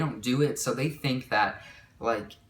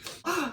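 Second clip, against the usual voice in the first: sharp, high intake of breath; acted gasp; 3 dB quieter than usual. Recalling when she started panicking, acted sharp gasp. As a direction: gasp as if panic just hit.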